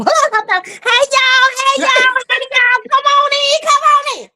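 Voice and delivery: very high, squealed sing-song greeting; excited sing-song welcome. Real, not acted. Host welcoming viewers into the show, high sing-song squeal. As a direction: usher everyone in with a high, giddy call.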